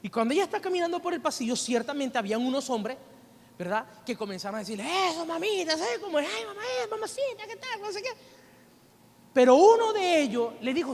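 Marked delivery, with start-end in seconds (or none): 5.1-8.1 high, soft mock catcalling; catcallers. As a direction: imitate street men tossing piropos in a high voice. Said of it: "piropeando, todo lo demás".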